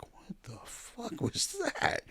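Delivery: high, slow, stunned what-the-hell; a jolted guest's high, slow disbelief; shocked outburst. Retold or imitated. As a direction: recoil at a sudden noise, drawn out. Reacting to the sudden wedding scream.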